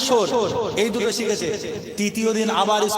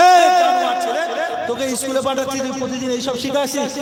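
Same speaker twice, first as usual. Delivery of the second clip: angry mother; 7 semitones higher than usual. Acted. Mother scolds son over daily swear-word questions, angry. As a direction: burst out scolding like an exasperated mother. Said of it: "ওর মা তখন একটু রেগে গেছে".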